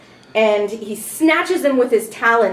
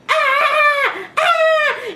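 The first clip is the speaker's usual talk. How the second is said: high screams. Acted. GM voicing distant travellers screaming in panic.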